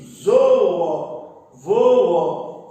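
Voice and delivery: teacher stretching "zoo, voo" into two syllables each; syllable-by-syllable pronunciation. Shown as a demonstration. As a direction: sound out both vowels in "zoo, voo". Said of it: "eu pronuncio".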